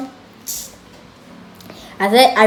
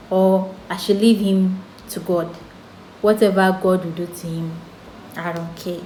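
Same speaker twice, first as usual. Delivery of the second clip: ordinary talk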